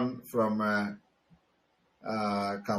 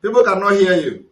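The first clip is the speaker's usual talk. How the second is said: loud and higher, calling over a bad line; raised voice; 6 semitones higher, 8 dB louder than usual. Real, not acted. Host calling to a faint caller, loud and higher. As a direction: push the voice out so the caller hears.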